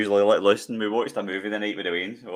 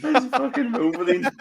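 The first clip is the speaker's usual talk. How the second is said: laughing. Real, not acted. Laughing at lookalike.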